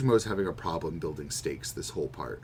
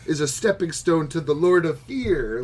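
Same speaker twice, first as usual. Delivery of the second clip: breezy mock-grand announcement, a bit higher and louder; mock casual grandeur; 6 semitones higher, 6 dB louder than usual. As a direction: toss off the doom like it's nothing. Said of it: "they're just casually being like".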